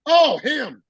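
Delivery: high-pitched imitated 'oh' of recognition; mock realization. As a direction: act out a chief suddenly recognizing someone. Chief's retold reaction on recognition.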